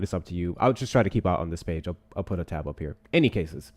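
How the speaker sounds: usual speaking voice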